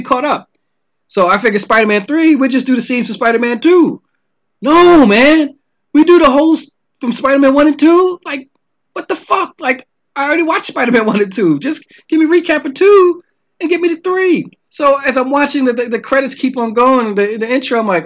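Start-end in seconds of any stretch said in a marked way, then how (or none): 4.6-14.5 incredulous, raised-pitch griping; exasperated rant. Real, not acted. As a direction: vent at the filmmakers in disbelief. Ranting about long intro.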